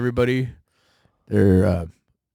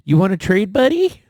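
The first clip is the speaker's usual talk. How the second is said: friendly trader; 9 semitones higher, 7 dB louder than usual. Retold or imitated. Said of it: "you go".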